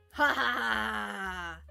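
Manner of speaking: slow, drawn-out mock laugh; fake villain laugh. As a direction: stretch out a fake "ha ha ha". Fake laugh after joking confession.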